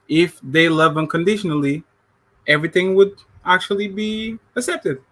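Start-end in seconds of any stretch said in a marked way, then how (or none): none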